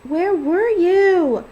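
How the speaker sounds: put-on high, loud, slow childish whine; whining. A narrator's reading. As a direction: drag it out like a sulking kid. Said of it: "she whines".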